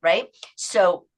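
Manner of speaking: normal talking voice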